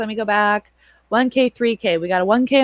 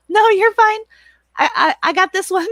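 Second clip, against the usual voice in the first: higher, quick, sing-song brush-off; mock-innocent mom; 12 semitones higher, 3 dB louder than usual. Mom telling her son she has her own soup, high and mock-sweet. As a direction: sweetly wave him off, hiding the joke. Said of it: "I'm like".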